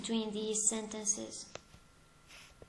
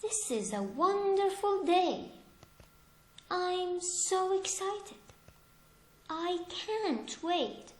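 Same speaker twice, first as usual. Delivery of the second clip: much higher, slowing down with eager joy; excited; 7 semitones higher, slower than usual. Shown as a demonstration. Teacher showing excitement to students, thrilled and eager. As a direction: gush like a child on a big day.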